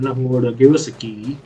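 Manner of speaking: ordinary delivery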